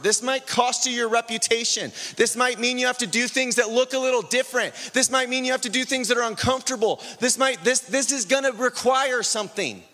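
rising from firm to high and urgent; building preaching. Real, not acted. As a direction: press each warning harder. Sermon climbs to full force.

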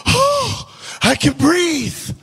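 high, airy burst of relief; gasping relief. Acted. Believer entering a room of faith, high acted relief. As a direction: let it out high, as if finally able to breathe.

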